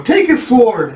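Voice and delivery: high, loud urgent order; calling out. Acted. Kids grabbing Sinha's sword, high excited shout. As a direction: shout it to your playmate.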